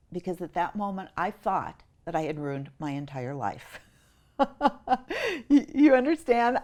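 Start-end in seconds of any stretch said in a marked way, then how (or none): 4.4-5.4 laugh. Real, not acted. Laughs at her own story.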